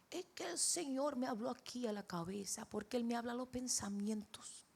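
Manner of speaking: imitating a Brazilian accent in Spanish; father's Brazilian-tinged Spanish. Father explains how God speaks to him, Brazilian-accented Spanish. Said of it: "en el tono de él".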